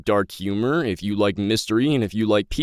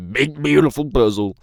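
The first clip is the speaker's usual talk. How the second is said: slipping into a presidential impression, slightly raised pitch; punchy mock-politician delivery; Donald Trump; 4 semitones higher than usual. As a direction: lightly imitate a bombastic politician's cadence. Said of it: "slipped into a Trump accent".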